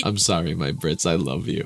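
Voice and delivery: ordinary talk, nothing marked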